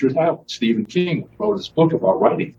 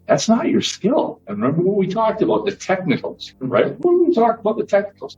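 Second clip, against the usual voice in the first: nun mentor; 10 semitones higher than usual. Retold or imitated. Nun tells frustrated student, higher, gently reassuring. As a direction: reassure him as the old nun. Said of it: "She goes".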